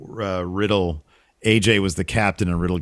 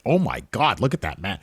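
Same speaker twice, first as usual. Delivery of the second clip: dropped fake accent; 3 dB quieter than usual. Zelina talking trash once she kept it real, mock catty aside. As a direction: imitate her dropping the posh act. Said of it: "when she kept it real".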